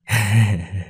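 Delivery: short eerie cackle; creepy cackle. Acted. Grandmother cackling at the terrified child, eerie. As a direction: cackle like something possessed.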